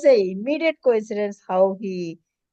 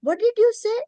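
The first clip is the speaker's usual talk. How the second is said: much higher, startled disbelief; shocked question; 13 semitones higher than usual. Retold or imitated. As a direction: blurt it out in shock. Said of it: "I said".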